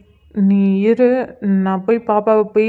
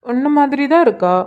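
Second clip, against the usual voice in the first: higher-pitched, fond assurance; fond praise; 5 semitones higher than usual. Acted. Husband telling wife the baby looks like her, fondly. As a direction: say it with a lift in pitch, admiringly.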